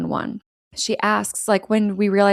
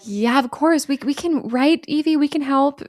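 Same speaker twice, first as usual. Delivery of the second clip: eager; 5 semitones higher than usual. Retold or imitated. Justin eager.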